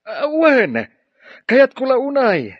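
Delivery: excited acceptance. Acted. Professor accepting the university job offer over the phone, thrilled. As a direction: jump at the offer with delight.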